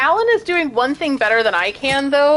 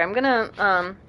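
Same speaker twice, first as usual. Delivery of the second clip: normal talking voice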